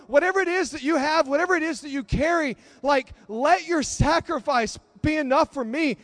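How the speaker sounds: preacher voicing a fervent plea; earnest plea. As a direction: plead urgently for mercy, voice lifted. Acts dying thief's plea to Jesus.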